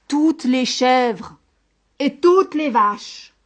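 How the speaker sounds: slow, loud, drawn-out storytelling; emphatic narration. A narrator's reading. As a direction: stretch each item out for horror. Narrator dramatizes the monster's devouring. (narration lente, forte et appuyée)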